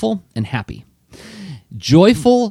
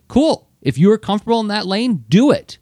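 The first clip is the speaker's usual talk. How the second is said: breezy encouraging; 10 semitones higher than usual. Retold or imitated. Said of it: "I'm like".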